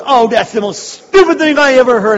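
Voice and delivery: shrill-free but loud, exaggerated scorn; scornful woman. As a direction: ham up her contempt. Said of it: "She goes".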